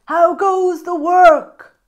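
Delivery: high, loud, slow bossy call; lazy farmer calling out to the duck, high and loud. Acted. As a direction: call down lazily from bed. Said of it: "called the farmer".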